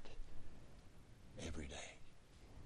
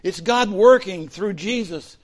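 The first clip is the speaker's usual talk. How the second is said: higher, louder, driving sermon voice; full-force preaching; 9 semitones higher, 9 dB louder than usual. Real, not acted. Pastor preaching to the congregation at full force. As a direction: preach it out with fire to the room.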